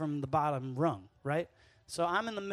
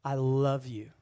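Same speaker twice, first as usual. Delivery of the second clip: low, loud declaration of love; voice from heaven; 4 semitones lower, 5 dB louder, slower than usual. Acted. Heaven speaking to Jesus, low resounding voice. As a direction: declare it from heaven, deep and full. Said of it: "the voice from heaven comes down and says".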